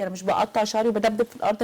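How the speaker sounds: ordinary talk, nothing marked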